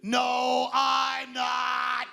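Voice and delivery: mildly raspy tough rock star yell. Shown as a demonstration. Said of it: "raspy, tough voice".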